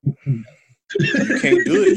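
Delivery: laughing while talking. Real, not acted. Laughing at accent attempts.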